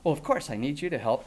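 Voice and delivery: usual speaking voice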